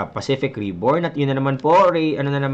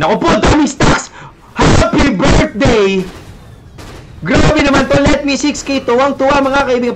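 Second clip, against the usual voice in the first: higher and louder, hyped caster yelling; excited shout; 10 semitones higher, 6 dB louder than usual. Real, not acted. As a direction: shout it out like a big play just happened. Big farm stack, caster hyped.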